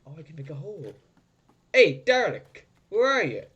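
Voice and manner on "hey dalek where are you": high, loud holler; calling out. Real, not acted. Player hailing a hidden Dalek, calling out loudly. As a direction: shout to draw it out.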